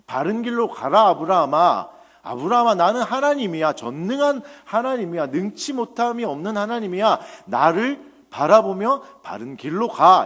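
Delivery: high, loud, insistent proclamation; God rebuking. Retold or imitated. God reproving Abraham toward the right path, forceful rebuke. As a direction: thunder the command like a preacher voicing God. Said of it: "이렇게 책망하고 계시는 것입니다".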